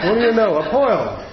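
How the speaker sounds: a light Jersey wiseguy surprise; New Jersey accent. Retold or imitated. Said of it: "I think he's from New Jersey".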